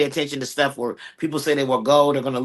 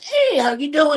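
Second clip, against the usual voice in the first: much higher, sing-song "how you doing"; hammed-up friendly greeting; 12 semitones higher, slower than usual. Guest mimicking the overly friendly Brit, very high. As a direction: push the friendliness way up. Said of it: "they're like".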